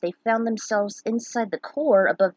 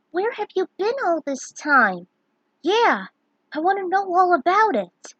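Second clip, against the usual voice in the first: put-on eager child voice; 9 semitones higher, 5 dB louder than usual. Kids asking eagerly.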